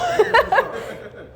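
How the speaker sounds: short high laugh; high giggle. Real, not acted. Joking about bleeping herself.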